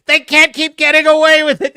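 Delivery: loud, high outraged protest; Jesse Pinkman. Acted. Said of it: "the Jesse Pinkman from Breaking Bad".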